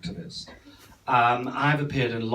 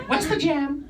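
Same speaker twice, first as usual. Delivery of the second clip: put-on high squeaky kid voice, much higher; child star catchphrase; 14 semitones higher than usual. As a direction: pipe it up like a tiny child star. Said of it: "Do you remember him?".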